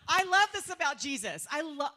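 ordinary talk, nothing marked

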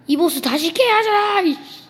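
high, loud frustrated complaint; frustrated outburst. Real, not acted. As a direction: yell in exasperation at the game. Losing to boss. (짜증 섞인 외침)

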